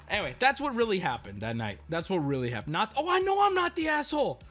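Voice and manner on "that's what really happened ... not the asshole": normal talking voice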